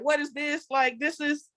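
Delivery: high, disbelieving grumbling voice; the people grumbling about the manna, high and incredulous; incredulous complaint. Retold or imitated. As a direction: sound like you can't believe this is your food. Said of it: "They was like".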